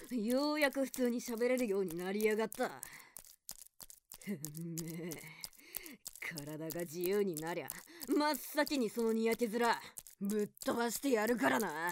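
low defiant threat. Acted. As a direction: growl the threat at his smirking face. (低くドスの利いた脅し)

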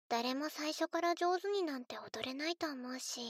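usual speaking voice

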